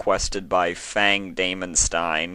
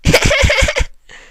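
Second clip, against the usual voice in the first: high squealing giggle; delighted high-pitched giggle; 12 dB louder than usual. Acted. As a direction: burst into a giddy squeal of laughter. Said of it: "clutching myself as I giggle".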